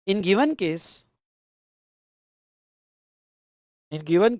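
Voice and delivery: very slow, each phrase said twice for writing down; slow dictation. Acted. Teacher dictating the case answer to students, slow and repeated. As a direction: dictate it phrase by phrase so the class keeps up.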